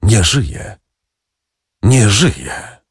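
hushed, stunned echo of bad news; understated, grave echo; echoing second voice. A narrator's reading. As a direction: repeat it like an echo, with a pause between. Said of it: "niczym echo, drugi głos mówiący".

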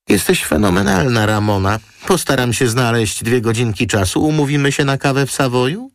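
very high, gushing model voice; high-pitched girlish chatter; effusive model. A narrator's reading. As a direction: gush your thanks, pitched right up. Model thanks Roma effusively.

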